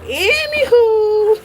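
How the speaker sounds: very high, loud, slow sung-out anywho; squealed, drawn-out anywho; P E's anywho. Retold or imitated. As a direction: stretch anywho way up and long like P E. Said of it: "as my girl P E would say".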